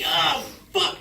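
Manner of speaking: high, loud mock yelp; acted-out jolt of shock; shocked cry. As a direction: yelp it as if suddenly invaded. Acts out shock of exam.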